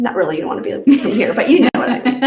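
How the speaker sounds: fast, laughing through the words. Real, not acted. Guest joking she'd take everyone home, laughing. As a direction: giggle as you take it back.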